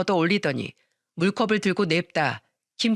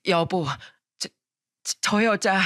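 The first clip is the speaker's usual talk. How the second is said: halting, stammered words of shock; trembling, struggling speech. A narrator's reading. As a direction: force the words out in broken pieces. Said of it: "부들부들 떨면서 힘겹게 말했어요". (떨면서 힘겹게 더듬는 말)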